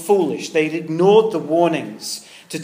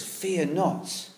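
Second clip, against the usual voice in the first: gentle reassurance; 7 dB quieter, much slower than usual. Retold or imitated. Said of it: "look what he says".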